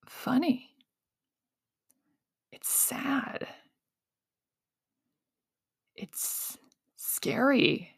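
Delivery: barely voiced, hushed words; whispered admission. Real, not acted. Founder quietly admitting the struggle was sad. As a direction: drop to a whisper on it. Sobering turn.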